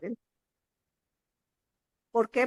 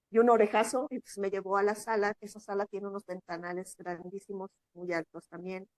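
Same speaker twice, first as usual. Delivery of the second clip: ordinary delivery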